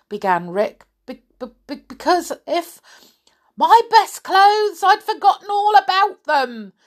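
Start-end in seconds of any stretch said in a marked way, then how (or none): 1.1-2.8 nervous stammer. Acted. 3.6-6.7 put-on old woman crying out. Old woman startled about her clothes, crying out high and loud. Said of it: "cried the old woman".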